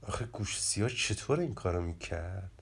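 low, soft grumble to oneself; muttering under the breath. A narrator's reading. Narenji, puzzling alone over Gush Siah's trick, muttering under the breath. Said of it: "زیر لب گفت".

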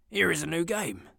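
excited plea to the spirit; Scrooge pleading. A narrator's reading. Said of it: "said Scrooge".

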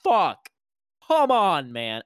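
loud, high exasperated outburst dropping on "man"; acted-out gamer frustration. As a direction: re-enact a frustrated cry at getting killed. Acts out being spawn killed.

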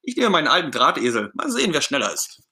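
high, bursting with eagerness; raring-to-go Ernie. Acted. Ernie challenging his pal Bert to a bike race, raring to go. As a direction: launch in high and eager, unstoppable. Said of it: "Ernie ist kaum noch zu bremsen".

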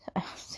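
short soft laugh at a slip; brief embarrassed laugh. Real, not acted. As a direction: chuckle quietly at yourself. Laughs at her own slip.

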